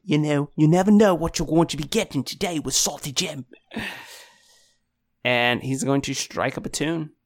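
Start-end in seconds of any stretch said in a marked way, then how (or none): 0.0-3.4 put-on showman Australian accent; brash Aussie showman pitch. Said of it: "he's Australian now".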